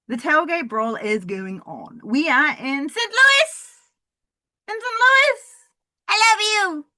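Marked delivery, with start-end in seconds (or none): 2.0-5.5 high, loud hyped announcing; excited announcer. Acted. 6.1-6.8 high, loud gushing call; loud declaration. Acted. Shouted to the crowd.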